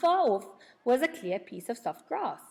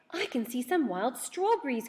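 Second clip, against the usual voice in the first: cried out; 5 semitones higher than usual. A narrator's reading. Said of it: "cried Beth".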